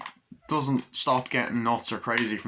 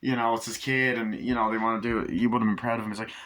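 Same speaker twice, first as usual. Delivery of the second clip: usual speaking voice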